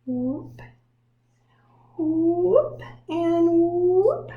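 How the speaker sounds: high, swooping whoops; playful whoop. Shown as a demonstration. Teacher voices the pen's curve for kids, high and playful. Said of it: "here's the big one".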